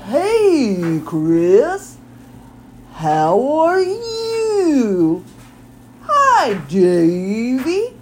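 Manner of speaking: slow, drawn-out swooping puppet voice; Goliath. Retold or imitated. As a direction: stretch each word, sliding way up in pitch. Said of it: "that's Goliath".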